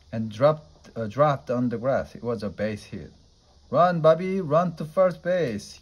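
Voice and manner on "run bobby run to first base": loud, high urgent yell. A narrator's reading. Willie urging frozen Bobby to run, yelling. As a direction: shout him down the baseline. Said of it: "yelled Willie".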